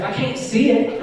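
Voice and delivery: high-pitched imitation of a kid; lightly put-on kid voice; kid answering back. Kid answers back in acted exchange.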